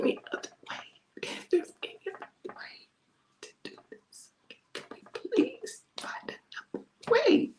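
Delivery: broken, barely audible whispered begging; anguished cry to God. Acted. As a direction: plead in a whisper that catches and leaps high. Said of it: "begins to cry out to God".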